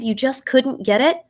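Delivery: plain reading voice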